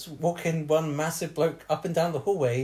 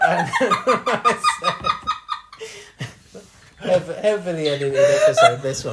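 louder, laughing through the words, near tears; can't stop laughing at his own gaffe; helpless laughter; 7 semitones higher, 8 dB louder than usual. Real, not acted. Joking to his co-hosts about editing, laughing till near tears.

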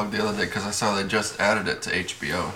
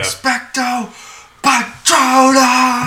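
very high, loud, long-drawn incantation; impassioned spell casting; 14 semitones higher, 8 dB louder, much slower than usual. Pretend. Imitating Harry getting into it, hammed-up spell cry. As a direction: belt out the spell, stretching the last word.

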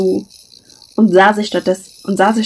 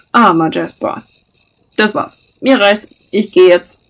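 huffy; 4 dB louder than usual. Acted. Jasper storming off offended.

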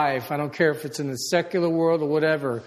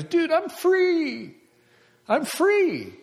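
much higher, slow, stretched free; joyful freedom; 12 semitones higher, much slower than usual. Retold or imitated. Pastor Mike telling the pastor he's free, high and joyful. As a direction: sing it out with relief. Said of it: "he goes".

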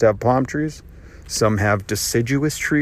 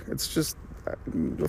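whispered; 2 semitones higher, 13 dB quieter, faster than usual. Real, not acted. Trailing off mid-rant.

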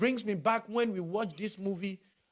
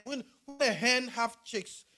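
usual speaking voice

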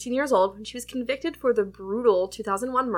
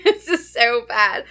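slow, loud words through laughter; real laugh spilling into drawn-out words; 2 semitones higher, 6 dB louder, slower than usual. Real, not acted. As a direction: laugh your way slowly through it. Laughs at how bad drink is.

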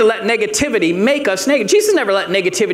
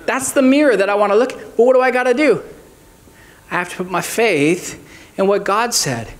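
normal talking voice

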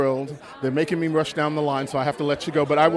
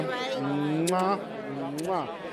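air kisses; 8 dB quieter than usual. Real, not acted.

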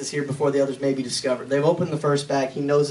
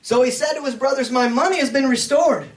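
scripture reading; 9 semitones higher, 4 dB louder than usual. Acted. Reads scripture aloud in sermon.

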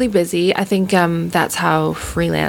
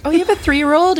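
much higher, playful fake surprise; mock surprise; 13 semitones higher than usual. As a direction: act startled at the news. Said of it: "and I'm like".

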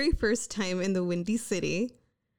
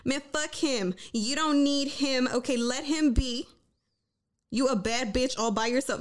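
lightly acted, higher-pitched defensive pep talk; defensive inner voice; 4 semitones higher than usual. Her mind talking to herself about him, defensive. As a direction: brush him off, talking yourself up. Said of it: "is usually very defensive".

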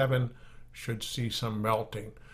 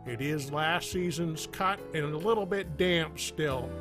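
upbeat radio commercial voice; ad read; 4 semitones higher, 4 dB quieter than usual. Acted. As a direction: sell it like a cheesy radio ad. Sponsor ad read over music.

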